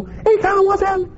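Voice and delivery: very high, squeaky cartoon-dog voice; friendly little dogs. Little dogs greeting their jogger buddy, high comic voice. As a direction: chatter like tiny talking dogs. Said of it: "they come out and they go".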